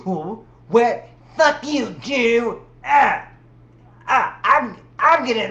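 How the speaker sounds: high, loud, mock furious outburst; mock tantrum. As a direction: throw a hammed-up tantrum. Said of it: "turns into, like, hate and screaming".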